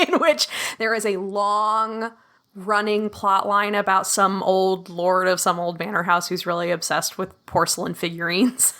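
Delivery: usual speaking voice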